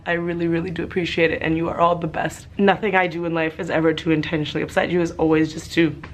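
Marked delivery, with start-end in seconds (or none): none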